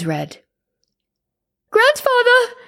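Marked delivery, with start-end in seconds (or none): 1.7-2.6 a high, loud, frightened cry. Acted. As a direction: scream his name in horror. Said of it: "I cried".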